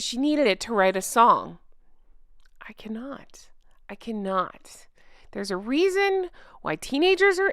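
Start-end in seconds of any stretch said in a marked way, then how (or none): 2.7-4.9 whispered, much softer disbelief; exasperated whisper. Real, not acted. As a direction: breathe it out, at a loss. Host overwhelmed by the scene.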